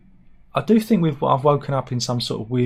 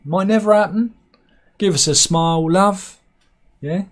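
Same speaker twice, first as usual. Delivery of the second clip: loud, hammed-up catcall, pitched higher; catcalling builder; 7 semitones higher, 5 dB louder than usual. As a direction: shout it across the street like a builder. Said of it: "Do you like going".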